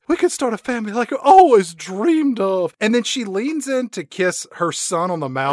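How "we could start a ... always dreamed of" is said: mock dreamy mother. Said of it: "Heather Graham says".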